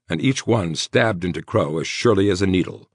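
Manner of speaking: plain reading voice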